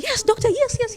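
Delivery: high, loud eager yeses; high joyful repeated yes; overjoyed yes. Retold or imitated. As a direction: burst out with relief. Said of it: "I'm like".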